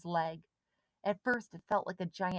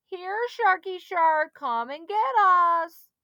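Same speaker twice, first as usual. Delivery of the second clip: high, loud, slow sing-song taunt; boy mocking the warning; taunting call; 12 semitones higher, 11 dB louder, slower than usual. A narrator's reading. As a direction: coax the shark like a pet dog. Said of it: "called through cupped hands".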